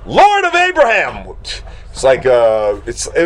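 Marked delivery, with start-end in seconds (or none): 0.1-1.3 high, long-held cry of disbelief; drawn-out, high-pitched shock; shocked exclamation. Acted. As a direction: wail it in mock astonishment. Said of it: "he's, like, shocked".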